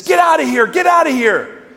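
loud, high retold shout; angry store owner. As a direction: bark it like an owner chasing someone off. Store owner orders woman away.

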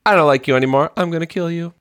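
put-on high, not aggressive little-kid threat; imitating young daughter. Father imitating daughter threatening her parents, high and unaggressive. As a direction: voice a child's threat, lifted and softening. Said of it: "how would she say it?".

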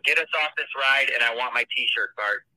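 normal talking voice

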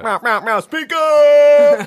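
loud, high mock radio sound effect; hammed-up DJ horn noises into a shouted slogan; radio DJ jingle. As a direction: shout it like a hyped DJ drop. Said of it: "if we were radio D Js, we'd, like, play".

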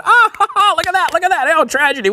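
high-pitched mock guffaw, gleeful crowing; hammed-up laughter at others' mishaps; mock laughing. Acting out laughing viewers of a stunt show, mock laughing. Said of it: "everybody's laughing".

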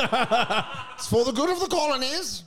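high, slow put-on aristocrat voice; hammed-up colonial husband calling his wife; pompous colonial gent.

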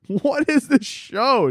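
slow, drawn-out, high incredulous question; mock disbelief. Viewer baffled by the show, slow disbelief. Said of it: "And you're like".

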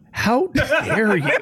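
high, slow, hammed-up outrage; mock outraged. Waiting for Chris Paul's reply, mock outrage. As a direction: drag it out, mock offended and laughing. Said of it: "I was just waiting for Scott Chris Paul to be".

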